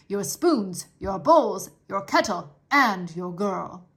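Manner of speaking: a lightly voiced dutiful soldier, firm and measured; stern soldier. The soldier laying out Uncle's choices, firm and stiff. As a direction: lay down the law, politely.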